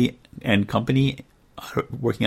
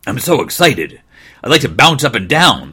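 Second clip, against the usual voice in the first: excited puppy; 5 semitones higher, 9 dB louder than usual. Acted. Excited puppy's thought in story.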